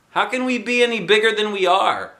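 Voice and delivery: put-on high, doubtful kid question; puzzled girl voice. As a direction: ask it like a confused little girl.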